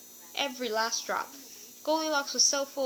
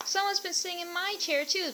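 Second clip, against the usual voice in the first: high little cub voice; Baby Bear crying out; 8 semitones higher than usual. A narrator's reading. Baby Bear telling his parents about his chair, high and small. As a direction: pipe up like a small upset cub. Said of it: "cried Baby Bear".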